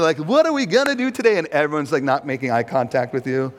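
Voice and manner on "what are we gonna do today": bright-eyed, high-pitched acted eagerness; hammed-up excited question, pitched up. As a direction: burst in eager for the day's plans. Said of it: "You walk in all excited".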